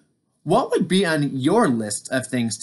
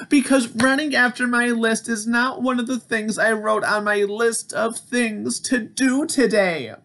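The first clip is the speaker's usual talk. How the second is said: wailing; 10 semitones higher than usual. Acted. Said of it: "wailed Toad".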